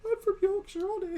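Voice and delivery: mocking someone protesting where he's from; mock defensive Yorkshireman. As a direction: insist on it like a squeaky, unconvincing Yorkshire lad. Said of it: "saying I'm from Yorkshire is like a".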